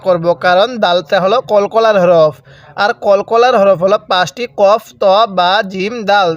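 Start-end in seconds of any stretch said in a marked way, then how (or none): none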